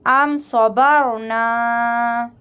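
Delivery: सबर with a heavy rounded r, very slow; tajweed demo of heavy ra. Shown as a demonstration. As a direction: draw out अम सबर ना with a thick रा. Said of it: "रा को मोटा पढ़ेंगे".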